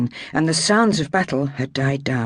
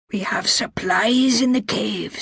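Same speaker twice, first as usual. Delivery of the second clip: whisper; 7 semitones higher than usual. A narrator's reading. Wounded Colin whispers.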